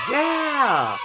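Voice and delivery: high happy cheer; excited yeah. Real, not acted. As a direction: shout it out, pumped to play. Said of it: "excited cheer before play-along".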